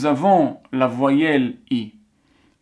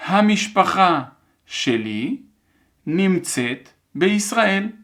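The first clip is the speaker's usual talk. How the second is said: very deliberate dictation, pausing after each word or two; careful, spaced-out classroom dictation; 4 semitones higher than usual. Shown as a demonstration. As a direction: dictate word by word for learners to write. Dernière fois.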